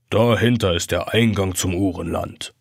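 low, deep clock voice; voice from the clock's belly. A narrator's reading. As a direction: rumble it from inside the case. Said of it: "aus dem Bauch der Standuhr".

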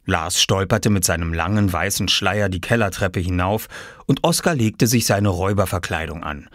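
ordinary delivery

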